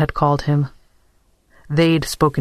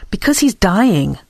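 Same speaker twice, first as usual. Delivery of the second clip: sharp, higher-pitched retort, lightly acted; snapped; 5 semitones higher than usual. A narrator's reading. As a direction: snap back with a touch of exasperation. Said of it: "Dora snapped".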